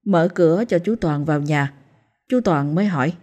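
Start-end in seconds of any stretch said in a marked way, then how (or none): none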